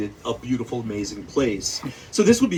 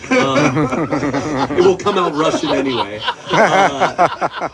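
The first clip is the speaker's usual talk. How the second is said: laughing through the words; giggling while talking; 6 semitones higher, 7 dB louder, slower than usual. Real, not acted. Laughing at own bad accent.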